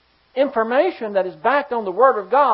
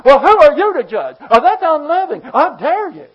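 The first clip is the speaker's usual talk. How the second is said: loud, scornful challenge, higher; challenging accuser; 4 semitones higher, 11 dB louder than usual. Acted. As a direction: throw it back at him like an accusation. Mocking accuser in acted exchange.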